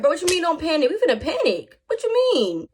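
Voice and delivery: mock outrage climbing up on what you mean; incredulous protest. Everybody protests "don't panic.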